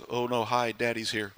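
a hint of kids dreading Dad coming home. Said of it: "it's like".

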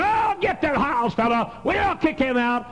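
mock enemies. Preacher mimicking enemies who wanted him out, high and sneering. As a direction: sneer it like his enemies did. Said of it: "how many people have said".

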